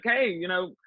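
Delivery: mock the editors as if nothing happened; imitating a flippant editor waving off the scandal; breezy editor brushing it off. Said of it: "and being like".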